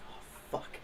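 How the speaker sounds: unvoiced whispered oh fuck; whispered curse. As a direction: breathe it out caught. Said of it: "And he's like".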